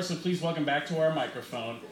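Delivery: ordinary delivery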